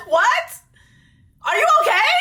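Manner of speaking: high, loud incredulous squeal; mock shock. Real, not acted. To the cast member on screen, high incredulous squeal. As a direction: squeal it like you can't believe it.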